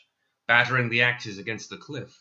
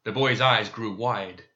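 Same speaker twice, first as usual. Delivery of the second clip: ordinary delivery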